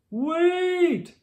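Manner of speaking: put-on high loud shout after the children. A narrator's reading. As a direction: shout after the runaway briefcase. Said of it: "shouted Mister Brown".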